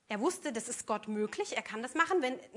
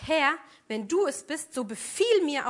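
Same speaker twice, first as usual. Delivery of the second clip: louder, slower plea from the boat; calling out to the Lord; 3 semitones higher, 8 dB louder, slower than usual. Acted. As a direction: call out across the water. Peter calling out to Jesus. (Petrus ruft lauter und langsam zu Jesus)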